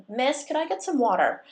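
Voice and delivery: loud, high call across the cafe. A narrator's reading. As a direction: call her over, cocky. Jock calling to barista.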